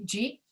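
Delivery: "Jeet" said as one quick syllable, rising; fused "Jeet". Shown as a demonstration. Teacher showing fast American "Jeet" to students, rising. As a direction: squash "Did you eat" into "Jeet". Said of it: "when you get up here and you say".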